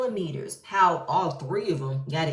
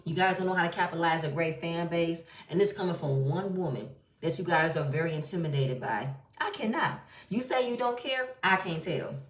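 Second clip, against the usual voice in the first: usual speaking voice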